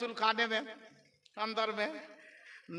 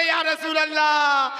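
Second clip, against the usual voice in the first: very loud rallying invocation; booming call to the congregation; forceful devotional shout; 2 semitones higher, 10 dB louder than usual. Shown as a demonstration. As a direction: shout it with full force for the crowd. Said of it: "और जोर से बोले".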